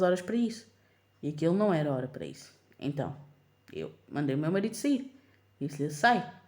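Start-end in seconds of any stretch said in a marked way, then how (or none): 5.9-6.3 firm command. Retold or imitated. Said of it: "ele percebeu que eu estava zangada".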